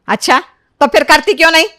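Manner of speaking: loud, high demanding scolding; scolding mother. Acted. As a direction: scold your daughter sharply. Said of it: "माँ से डांट खा रही थी". (डाँटते हुए ज़ोर से)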